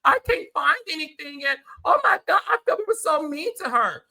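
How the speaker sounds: high, loud mocking whiny voice; sarcastic high mimicry of the cast; mocking castmates. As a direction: mimic them, hammed-up and high. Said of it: "y'all had sympathy ... and said".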